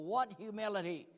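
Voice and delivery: ordinary talk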